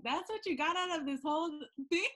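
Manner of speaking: loud, high incredulous comeback; high-pitched playful outrage, close to laughing; mock disbelief. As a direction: tease a friend with exaggerated disbelief. Mock disbelief at friend's takeaway.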